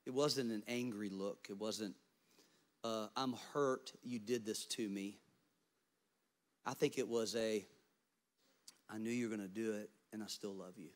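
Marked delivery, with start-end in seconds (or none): 3.2-10.9 softer, gentle reassurance; lightly voiced Jesus, soft and loving; a hint of tender Jesus. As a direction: forgive him quietly. Voicing Jesus' gentle look.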